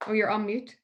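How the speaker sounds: ordinary delivery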